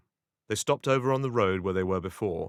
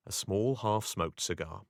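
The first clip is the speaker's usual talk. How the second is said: ordinary delivery, nothing marked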